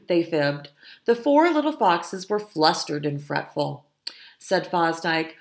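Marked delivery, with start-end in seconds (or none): none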